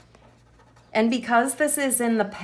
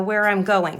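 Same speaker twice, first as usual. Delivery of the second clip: normal talking voice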